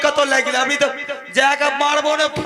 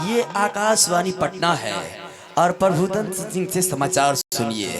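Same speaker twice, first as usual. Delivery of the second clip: radio announcer; 6 semitones lower than usual. Pretend. Radio news announcer imitation.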